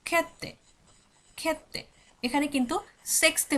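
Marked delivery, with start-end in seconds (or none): 0.1-1.8 খেতে said higher and sharp as Swedish "khe-te", the second take softer; Swedish pronunciation demo. Shown as a demonstration. Teacher drills the Swedish sixth for students, careful pronunciation. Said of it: "তখন বলা হচ্ছে খেতে".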